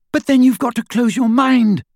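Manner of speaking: high, loud and insistent; shrill urgent plea. A narrator's reading. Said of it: "said Hermione shrilly".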